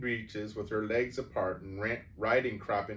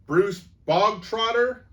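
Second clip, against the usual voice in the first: much higher, much louder, slow bark; 12 semitones higher, 12 dB louder than usual. A narrator's reading. Headmistress bellowing for a pupil, loud slow bark. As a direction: bellow the name across the hall. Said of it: "the Trunchbull barked suddenly".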